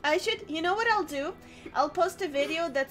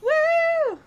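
cheering; 11 semitones higher, 8 dB louder than usual. Real, not acted. Cheering about podcast goal.

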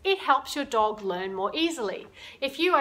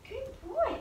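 high, soft praise; dog praise; 13 semitones higher, 8 dB quieter than usual. Real, not acted. Trainer softly praising her dog, high. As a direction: lift it way up for the dog.

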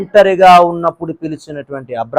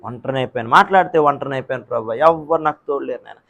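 much softer, lower whimpering complaint; whimpering lament; 4 semitones lower, 7 dB quieter than usual. Acted. Believer whining to God about loneliness, soft low whimper. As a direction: moan it almost under the breath.

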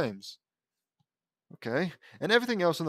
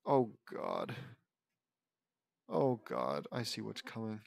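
scared; 4 semitones lower, 8 dB quieter, slower than usual. Acted. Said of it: "a little scared".

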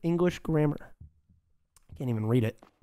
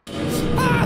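sudden high loud scream; startled scream; 4 dB louder than usual. Real, not acted. Startled by bird in game.

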